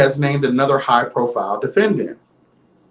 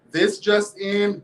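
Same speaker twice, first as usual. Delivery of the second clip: slow, quieter, teasing repeat of the headline; softer mock news intro; 5 semitones higher, 4 dB quieter, slower than usual. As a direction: lean in and say it softly. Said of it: "this just in announcement".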